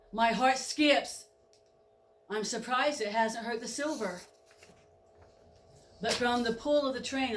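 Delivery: ordinary delivery, nothing marked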